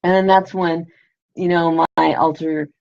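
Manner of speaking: normal talking voice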